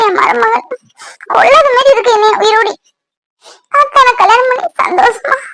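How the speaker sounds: high, sobbing lament over a lost match; crying. Acted. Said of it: "ஐசா அழுதுட்டு இருக்கா".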